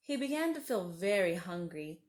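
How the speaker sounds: plain reading voice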